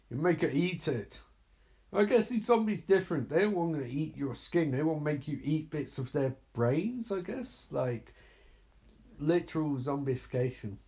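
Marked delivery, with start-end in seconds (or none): none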